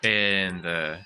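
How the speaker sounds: ordinary talk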